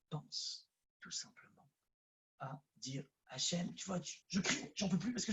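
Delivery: soft, near-whispered plea to God; a worshipper whispering his despair to God; hushed intimate prayer. Real, not acted. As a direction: murmur it as a secret confession. Softly voicing a prayer.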